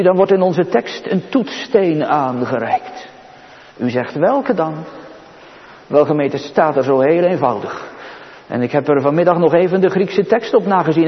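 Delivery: usual speaking voice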